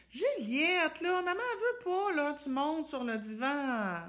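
high plaintive whine; complaining mom. Shown as a demonstration. Mother complaining to Juliette about the couch, high whine. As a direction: whine the request like a tired mother. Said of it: "Je suis dans de la plainte".

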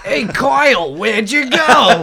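a hint of a scolding mom; mom impression.

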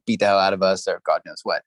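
usual speaking voice

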